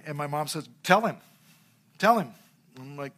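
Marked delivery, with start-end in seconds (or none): 0.8-2.3 firm, raised voice as a mother commanding; a short, louder parental command, said twice; stern mom's order. Retold or imitated. Mom orders her little boy to confess to the manager, sternly.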